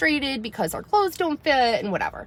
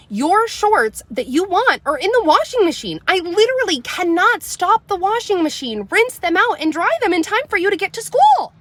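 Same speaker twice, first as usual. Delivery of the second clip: loud, high exasperated yelling at her daughter; losing it; 9 semitones higher, 5 dB louder than usual. Retold or imitated. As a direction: snap and rise to a frantic yell. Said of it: "I lost my shit a little bit on purpose".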